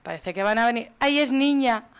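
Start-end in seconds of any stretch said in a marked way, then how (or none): 1.0-1.9 excited squeal of news, higher and louder. Real, not acted. Player learns the baby is a girl, excited. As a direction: burst out with the happy news.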